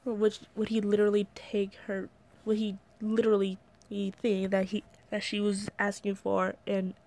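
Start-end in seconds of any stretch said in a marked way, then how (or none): none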